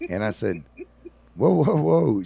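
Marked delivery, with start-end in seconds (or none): 1.3-2.2 high, loud whoa; alarmed whoa. Retold or imitated. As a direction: call out to stop her, loud and high. Calming angry woman.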